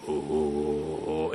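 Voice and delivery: deep, soft held "oh"; imitated really low note. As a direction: sink to a cartoon tenor's lowest note. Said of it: "It goes really low".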